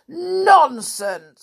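high, loud scornful snort; snorted dismissal. Acted. Stubborn listener dismissing her friend's unicorn tale, snorting. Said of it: "snorted little Miss Stubborn".

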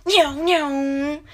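playful imitated meows while chasing; running meows. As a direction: meow twice as if racing along. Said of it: "me and this guy were like".

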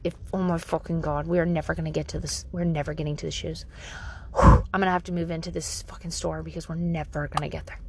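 low, quick grumble under the breath; exasperated inner voice. Retold or imitated. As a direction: grumble the impatient thought to yourself. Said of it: "deep down inside, that voice that's like".

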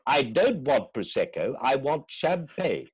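high, grandly indignant demand; slow, emphatic outrage, drawn out; Richard Wilson. Acted. Friend mimics the actor refusing a drink, indignant impression. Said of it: "That's a marvellous impression of the".

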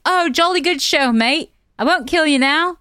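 lightly voiced jolly British bloke; a hint of a cheery English accent; mock British villain. Podcaster mimicking the killer's British send-off, mock jolly English. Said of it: "Do his accent".

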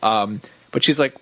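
usual speaking voice

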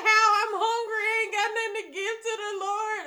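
high, loud, fast hammed-up whine; mock hungry whine. Answering her pastor, whining about hunger. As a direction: complain fast like a starving kid. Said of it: "I was like".

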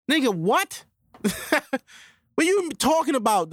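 high, incredulous scoff with a laugh; mocking disbelief. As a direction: act shocked they'd hate iPhones. Acting out iPhone friend scoffing.

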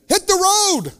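high, sharp, punchy retort; cheeky brush-off; blunt dismissal. Real, not acted. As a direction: send them off without apology.

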